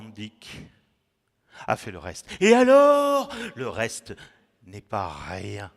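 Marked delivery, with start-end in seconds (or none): 2.4-3.3 sudden high, loud exclamation; declaimed outburst. Acted. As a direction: burst out theatrically, holding the last syllable. Exclamation soudaine du poème. (exclamation théâtrale, forte et aiguë)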